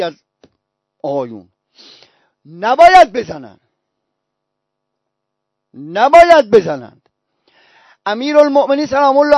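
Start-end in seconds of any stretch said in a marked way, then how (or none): 2.5-3.6 «نباید» swelling loud, then falling, drawn out; emphatic warning. Real, not acted. Preacher warning listeners what must not be said, «نباید» stressed loud and slow. 5.7-7.0 slow, loud swell on «نباید», falling away; emphatic warning. Real, not acted. Preacher driving a warning home to listeners, «نباید» long and loud.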